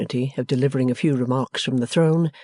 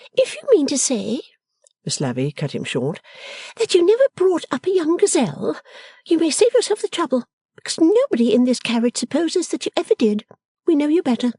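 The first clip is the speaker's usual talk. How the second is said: put-on high, whiny young daughter; petulant girl; 3 dB louder than usual. A narrator's reading. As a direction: complain like a spoilt girl. Lavvy cutting in.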